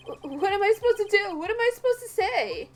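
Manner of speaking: panicked. Acted. Defense attorney panicking when the judge demands a plan. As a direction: blurt it out, totally cornered.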